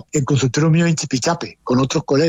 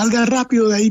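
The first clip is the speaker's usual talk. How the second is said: mock urgent call, pitched well up; retold urgent warning; 7 semitones higher than usual. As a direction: imitate bystanders calling someone out of danger.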